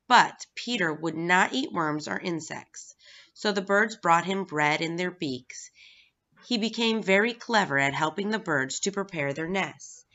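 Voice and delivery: plain reading voice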